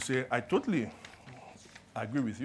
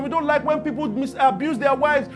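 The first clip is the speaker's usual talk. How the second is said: louder, higher rally preaching; preaching at full force; 7 semitones higher, 8 dB louder than usual. Acted. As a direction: rouse the congregation on justice.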